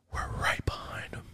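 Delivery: "We're right behind 'em" whispered, voiceless and soft. Real, not acted. Quietly dropping the big news.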